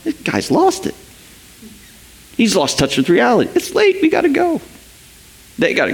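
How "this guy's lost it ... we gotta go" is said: disciples exasperated. Retold or imitated. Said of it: "the disciples are going".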